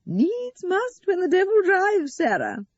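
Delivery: high, loud, drawn-out martyrdom; strained smile. A narrator's reading. Eleanor resigned to football, strained and martyred. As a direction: force it through a strained smile, slowly. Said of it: "Mother gave daughter a strained smile".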